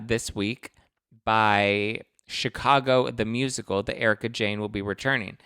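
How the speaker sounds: ordinary talk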